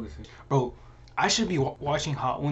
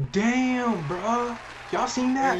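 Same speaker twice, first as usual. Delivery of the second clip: excited; 10 semitones higher, much slower than usual. Real, not acted. After one-bite challenge.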